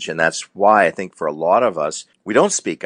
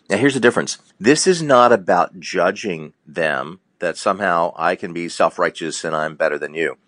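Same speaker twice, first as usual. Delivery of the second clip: usual speaking voice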